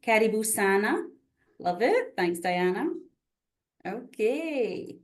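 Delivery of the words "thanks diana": normal talking voice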